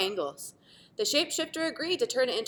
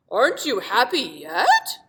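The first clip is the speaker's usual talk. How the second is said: shapeshifter voice; 2 semitones higher, 8 dB louder than usual. Acted.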